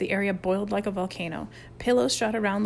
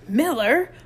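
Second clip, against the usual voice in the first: MILLER yelled high and loud; "Miller" shouted with a rising end; howled; 6 semitones higher, 6 dB louder than usual. A narrator's reading. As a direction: howl "Miller" as you charge up. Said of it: "He charged up, howling".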